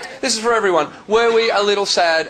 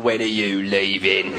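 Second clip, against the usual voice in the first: low, slow, drawn-out question; unwelcoming official; 9 semitones lower, 4 dB quieter, slower than usual. Acted. London official to an arriving Aussie, low slow unwelcome. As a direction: ask it slowly, wanting them gone.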